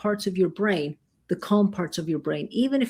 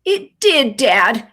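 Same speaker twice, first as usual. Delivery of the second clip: put-on higher, louder little boy answering; child voice; 6 semitones higher, 9 dB louder, much slower than usual. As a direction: play the four-year-old agreeing. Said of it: "looked at him and go".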